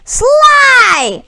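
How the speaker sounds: high, loud furious roar. Acted. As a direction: bellow the villain's name. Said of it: "roared Barkus".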